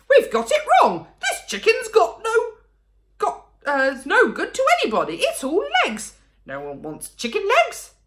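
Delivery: put-on high, loud, flustered old man; dismayed Mister Cranky. A narrator's reading. Mister Cranky finding the chicken all legs, flustered high cry. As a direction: splutter with comic dismay.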